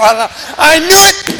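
very high, booming retold yell; mock outburst. Bible reader erupting at the pastor's reveal, high mock shout. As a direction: explode like an outraged believer pounding the table. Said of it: "And he's banging on the table".

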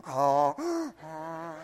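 imitated donkey-like gasping; noisy gasp in, then "oh, oh"; mock the congregation's audible breathing; mimicked breathing noises. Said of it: "I hear some of you in here going".

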